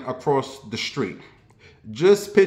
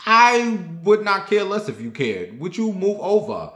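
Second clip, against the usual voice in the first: exasperated retort; 6 semitones higher than usual. Retold or imitated. Annoyed at pushy stranger.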